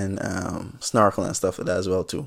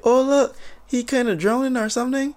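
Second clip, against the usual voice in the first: much higher, airy oblivious bystander; casual onlooker voice; 13 semitones higher than usual. Retold or imitated. People on the boat watching him drown, airy high chirp. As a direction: chirp it like it's no big deal.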